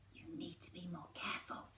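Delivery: hushed, unvoiced warning; whisper. A narrator's reading. Alberic warning her in a whisper. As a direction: whisper it urgently, close to her ear. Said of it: "he whispers".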